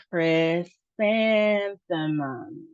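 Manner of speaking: "chrysanthemum" very slow, syllable by syllable; slow sounding-out. Shown as a demonstration. Teacher helping learners try it, slow sounding-out. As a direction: stretch "chrysanthemum", holding the second syllable "san". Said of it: "So let's try it".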